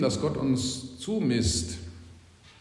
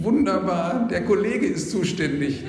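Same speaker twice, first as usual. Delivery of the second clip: relieved delight; 5 semitones higher than usual. Retold or imitated. Relief at not being responsible.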